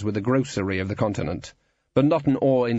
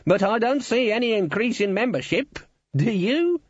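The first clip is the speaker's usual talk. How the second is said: very high character voice; indignant guild boss; 12 semitones higher than usual. A narrator's reading. Guild boss querying membership figures, indignant. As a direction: press the question, rising sharply in pitch. Cleet presses his underling.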